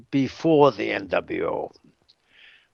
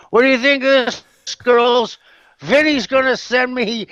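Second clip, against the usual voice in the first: imitating an eager braggart, higher pitched; high, louder mock excitement; excited boasting; 9 semitones higher, 3 dB louder than usual. Man showing off a magazine picture, excitedly.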